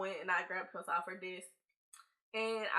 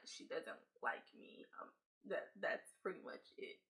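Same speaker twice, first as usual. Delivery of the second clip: mumbled whisper; 11 dB quieter than usual. Retold or imitated. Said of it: "mumbled aside to office lady".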